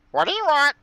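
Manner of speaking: high squawky bird voice; parrot voice. Acted. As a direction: screech it like a parrot.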